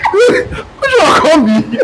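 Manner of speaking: slow, laughing, high exclamation; high, slow laughing outburst; laughing disbelief. Retold or imitated. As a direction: drag it out through laughter. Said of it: "I'm like".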